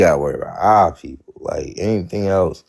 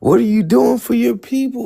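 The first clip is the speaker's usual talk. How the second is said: confronting quote; 6 semitones higher than usual. Retold or imitated. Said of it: "I'm just be like".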